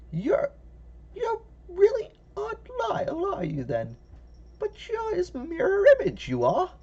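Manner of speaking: put-on high, trembling old woman cry; frightened old lady. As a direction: panic like a scared granny.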